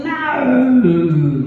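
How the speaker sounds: a cranky granny turning it down; old lady no. Retold or imitated. Old woman refusing the shop man's offer, high and loud.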